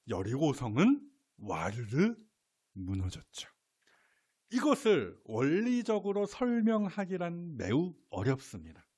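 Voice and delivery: usual speaking voice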